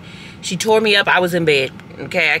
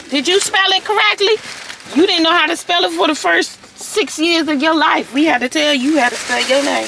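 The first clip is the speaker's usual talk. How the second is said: much higher and louder, sassy comeback; mock scolding kid; 11 semitones higher, 12 dB louder than usual. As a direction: talk back to a know-it-all child. Scolding kids who correct her.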